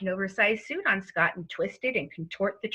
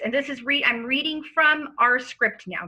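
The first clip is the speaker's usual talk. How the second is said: normal talking voice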